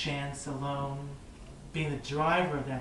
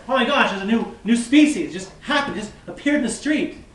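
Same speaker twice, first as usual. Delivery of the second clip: louder, higher mock astonishment; mock amazement; 5 semitones higher, 7 dB louder than usual. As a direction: gasp at an impossible sight, playfully. Imagined bystander amazed at new species.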